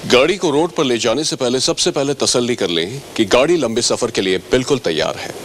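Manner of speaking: usual speaking voice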